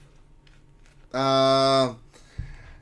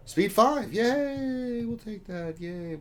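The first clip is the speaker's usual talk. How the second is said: much higher, happy cheer; delighted; 13 semitones higher, much slower than usual. Real, not acted. Streamer celebrating a lucky mod roll, high and gleeful.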